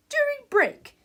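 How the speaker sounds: high, loud teacher voice; stern teacher. A narrator's reading. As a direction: announce it over a noisy classroom. Teacher dashing the class's hopes.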